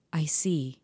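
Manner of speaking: breathy hushed "I see"; mock whisper. Mocking Peter's late realization, whispered. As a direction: whisper it like a slow dawning.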